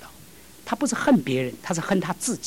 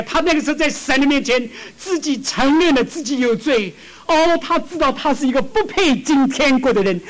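higher, louder impassioned sermon; fervent preaching; 7 semitones higher, 4 dB louder than usual. Acted. Preacher telling of the repentant thief, impassioned. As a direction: plead it from the pulpit.

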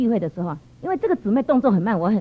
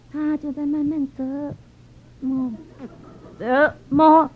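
drawn-out mimicry of slow folding; painfully slow, word by word; slow dawdling; 2 semitones higher, much slower than usual. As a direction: drag each word out, imitating someone who dawdles.

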